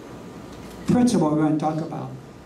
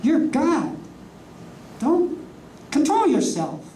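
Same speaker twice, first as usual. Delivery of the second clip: mock scolding plea; 2 semitones higher than usual. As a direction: chide him like an exasperated friend. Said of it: "I said".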